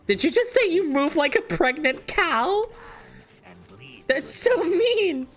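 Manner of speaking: squeaky hurt high voice; whiny falsetto, acting wounded; high offended voice. Mock-offended at game insult.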